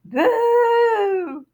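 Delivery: high, loud spooky boo; big ghostly boo. Acted. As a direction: leap out and yell it. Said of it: "and said".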